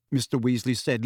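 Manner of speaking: ordinary delivery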